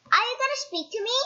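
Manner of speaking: high, fast goblin question. Acted. Goblin pestering silent Mister Uppity, high and quick. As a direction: needle him quickly like a goblin. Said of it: "asked the goblin".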